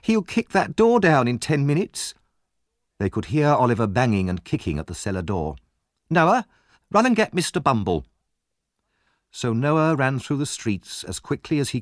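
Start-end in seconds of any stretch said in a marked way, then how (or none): 0.0-2.1 high, worried exclamation; flustered, pitched up; crying out in alarm. Acted. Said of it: "cried Missus Sowerbury". 6.1-8.1 high, urgent order; flustered command, pitched up; urgent alarm. Acted. Woman urgently sending for help.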